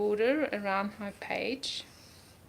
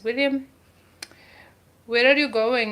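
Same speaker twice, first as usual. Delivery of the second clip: louder, lightly put-on Scottish accent; 2 semitones higher, 7 dB louder than usual. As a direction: call it out in an amateur Scottish accent. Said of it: "William Wallace, uh, accent".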